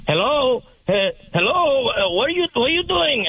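put-on high-pitched slurring drunk caller. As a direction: imitate a hammered man fumbling through hello. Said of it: "he sounds drunk".

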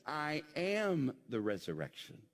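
"am" lifted and held; stress on AM. Acted. Preacher quoting Jesus before the cross, stress on "am". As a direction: lean on "am" to mark the present tense. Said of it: "present tense".

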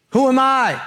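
high-pitched incredulous protest; Moses. Acted. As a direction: play a reluctant prophet, voice jumping up in disbelief. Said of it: "Moses' answer".

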